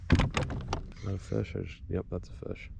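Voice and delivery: slow soft whisper, barely voiced; breathless hushed check. Real, not acted. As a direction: whisper as if not to scare the fish. Feeling a bite, hushed.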